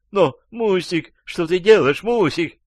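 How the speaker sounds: high-pitched female pleading; умоляющая женщина. A narrator's reading. (жалобный высокий женский голос)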